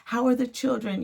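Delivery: high, bright greeting in the neighbor's voice; cheerful neighbor. Retold or imitated. Imitating the smiling neighbor greeting her, high and cheery. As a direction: chirp it like a friendly neighbor. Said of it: "And he's always".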